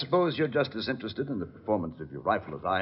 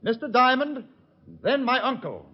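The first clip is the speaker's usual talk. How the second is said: calling out; 12 semitones higher, 4 dB louder than usual. Acted. Shouting orders to distant henchman.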